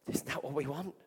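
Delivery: ordinary delivery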